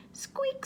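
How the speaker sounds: high thin squeak like a swinging lantern; squeaky lantern. Acted. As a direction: squeak it out like an old rusty lantern. Lantern squeak sound effect.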